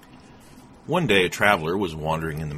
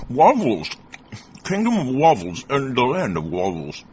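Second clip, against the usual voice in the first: loud, higher, words through food; mouth full; 6 semitones higher, 7 dB louder than usual. A narrator's reading. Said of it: "tried to speak with food in his mouth".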